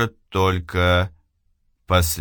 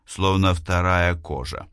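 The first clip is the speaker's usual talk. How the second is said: usual speaking voice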